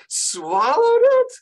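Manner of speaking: very high, slow "Swallowed it?"; teacher replaying a student's shocked line; imitating disbelief. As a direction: echo Rosie's horrified question. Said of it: "'cause Rosie just said".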